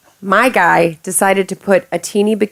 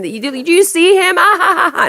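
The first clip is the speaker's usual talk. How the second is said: fans' call; 10 semitones higher than usual. Retold or imitated. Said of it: "like, turn around and".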